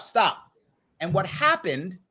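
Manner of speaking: ordinary delivery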